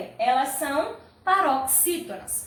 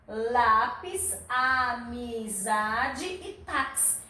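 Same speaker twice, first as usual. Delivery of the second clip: lápis, amizade e táxi slowly, syllable by syllable; separated syllables; 3 semitones lower, much slower than usual. Shown as a demonstration. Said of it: "separe as sílabas dessas palavras". (lápis, amizade e táxi devagar, separando as sílabas)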